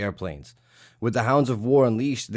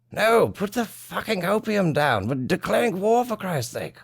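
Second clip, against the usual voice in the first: a flustered British gentleman declaring war; hammed-up upper-class English accent; posh British; 3 semitones higher than usual. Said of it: "Britain steps in here and they're like".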